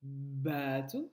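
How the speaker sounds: "Bateau" with a held b and long "baaa"; slow, low-starting "baaa-teau"; stretched first syllable. Shown as a demonstration. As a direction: draw out "Ba" low, then lift on "teau". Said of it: "ça commence par B".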